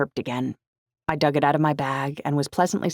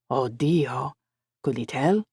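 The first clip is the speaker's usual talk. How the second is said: put-on concerned friend with an Italian accent; lightly voiced Italian-accented friend; rich Italian accent; slower than usual. A narrator's reading. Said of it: "his rich Italian accent".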